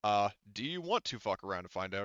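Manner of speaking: ordinary talk, nothing marked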